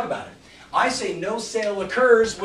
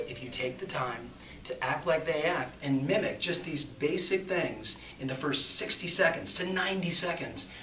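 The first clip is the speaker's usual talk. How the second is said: ordinary delivery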